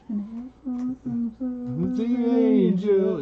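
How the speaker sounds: long high-pitched mock dog whine; imitated dog whine. Owner imitating his dog at the shelter, drawn-out whining. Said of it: "and he's like".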